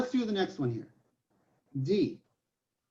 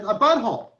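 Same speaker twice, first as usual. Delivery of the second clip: mild mock yell, raised pitch and volume; retold child's yell; 5 semitones higher, 5 dB louder than usual. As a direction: imitate a small daughter calling out, half-heartedly. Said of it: "and then I heard it again".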